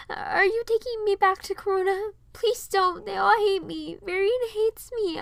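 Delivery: put-on very high, childlike, pleading; begging. A narrator's reading. Piper begs Rapunzel not to take her to Corona, in a high pleading child voice. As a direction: plead not to be sent back. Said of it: "I begged".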